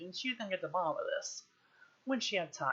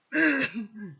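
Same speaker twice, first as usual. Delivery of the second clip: throat clearing; 3 semitones higher, 9 dB louder than usual. Real, not acted.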